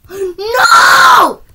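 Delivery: high, loud cry of dismay; anguished shout. Real, not acted. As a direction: scream no as you fall.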